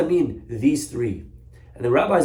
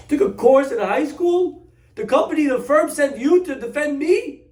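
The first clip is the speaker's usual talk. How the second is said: a defendant who can't believe his ears, pitched way up; shocked disbelief; 14 semitones higher, 4 dB louder than usual. Acted. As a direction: defendant aghast at his teenage lawyer, high squeal. Defendant outraged at kid lawyer.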